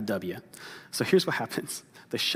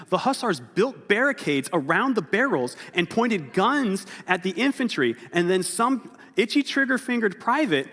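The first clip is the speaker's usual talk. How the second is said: higher and louder, racing to the climax; excited storytelling; 7 semitones higher, 5 dB louder than usual. A narrator's reading. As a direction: build the chaos, higher and louder. Story climax told with rising excitement.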